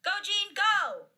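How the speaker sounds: high, loud cheer; shouted playground encouragement; called out. A narrator's reading. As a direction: cheer like a kid from the sidelines. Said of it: "a kid called out".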